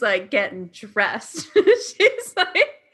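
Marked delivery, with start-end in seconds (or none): 1.5-2.8 laughing. Real, not acted. Laughs retelling friend dressing.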